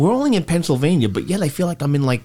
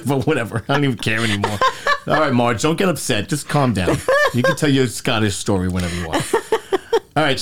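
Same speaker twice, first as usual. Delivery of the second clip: ordinary talk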